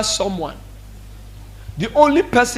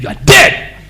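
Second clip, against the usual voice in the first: mock threat; 10 semitones higher, 3 dB louder than usual. Spiritual father's playful threat.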